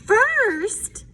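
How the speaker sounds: firm motherly reminder; Mom voice. Acted. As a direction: mom starting another list of chores. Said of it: "said Mom".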